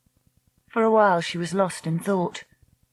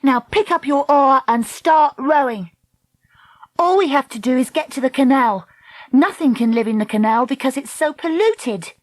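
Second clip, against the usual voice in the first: stern orders in a raised pitch; 8 semitones higher, 3 dB louder than usual. A narrator's reading. Dakota ordering Treacle to row for the canal, stern. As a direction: take charge firmly, voice pitched up. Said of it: "Dakota said sternly".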